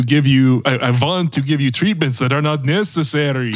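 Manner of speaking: hammed-up Transylvanian accent; Dracula impression. Co-host plays a dental-school vampire to friends, Dracula accent. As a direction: play it as a comic Dracula accent. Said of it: "of a Dracula who went to dental school".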